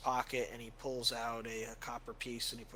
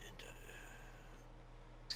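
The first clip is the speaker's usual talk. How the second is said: put-on high, frail old cleric fading to a whisper; a tired old father losing his train of thought; weary old priest; 3 semitones higher, 5 dB quieter, slower than usual. Old priest greeting returning adventurers, trailing into a whisper.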